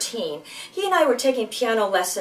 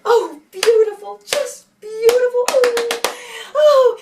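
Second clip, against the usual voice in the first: much higher and louder rapturous praise; gushing piano teacher; 12 semitones higher, 5 dB louder, slower than usual. Retold or imitated. Teacher praising the brother's playing, high rapturous coo. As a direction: swoon over every note. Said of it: "she would say".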